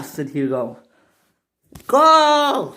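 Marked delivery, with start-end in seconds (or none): 1.9-2.7 high, loud one-word order; shout. Acted. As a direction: yell it as an impatient command. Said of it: "shouted the passengers".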